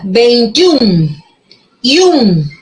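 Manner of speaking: "Veintiún" with a long, stressed final "ún". Shown as a demonstration. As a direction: lean on the U in "Veintiún" and "Y un". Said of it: "U con fuerza de voz". ("Veintiún", con fuerza de voz en la u)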